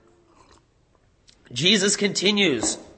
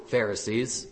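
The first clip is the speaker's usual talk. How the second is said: quiet scorn; 6 semitones lower, 7 dB quieter than usual. Acted. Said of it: "he does this by mocking them".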